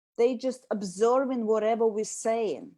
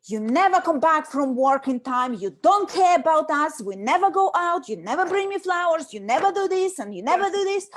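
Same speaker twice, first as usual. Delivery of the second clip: louder, rising list of complaints; accusing nag; 4 semitones higher, 5 dB louder than usual. Shown as a demonstration. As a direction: pile the blame on the husband. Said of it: "if I start saying".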